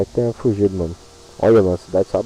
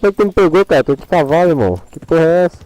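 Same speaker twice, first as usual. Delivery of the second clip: frustrated yell; 8 semitones higher, 7 dB louder, faster than usual. Real, not acted. Gamer confused at bugged capture.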